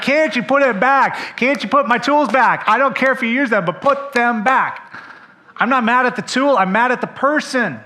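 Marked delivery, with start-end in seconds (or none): 0.0-4.8 higher, louder fed-up parent; hammed-up annoyed dad; mock exasperation. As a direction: scold the kids about the tools. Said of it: "I'm like".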